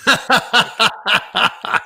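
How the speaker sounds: laughing through the line, drawn out; laughing punchline. Real, not acted. Telling the football story's punchline while laughing. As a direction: let the laugh break into the words.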